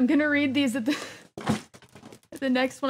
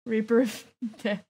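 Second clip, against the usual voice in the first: ordinary talk, nothing marked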